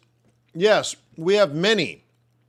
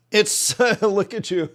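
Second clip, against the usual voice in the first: laughing it out, higher; 6 semitones higher, 4 dB louder than usual. Real, not acted. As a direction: chuckle through the words. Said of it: "I'm not laughing at you".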